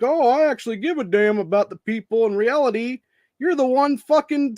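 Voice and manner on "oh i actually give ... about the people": sarcastic mock-caring politician voice; mocking fake sincerity. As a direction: sneer it like a phony. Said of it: "act like".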